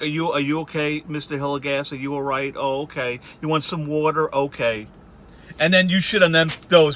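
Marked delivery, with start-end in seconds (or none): 0.0-4.9 softer, coaxing bedside voice; gentle nurse. Retold or imitated. Nurse checking on a lonely old patient, softly and gently. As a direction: soothe him like a patient nurse. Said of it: "you're like".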